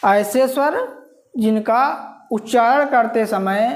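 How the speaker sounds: ordinary talk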